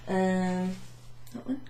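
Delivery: normal talking voice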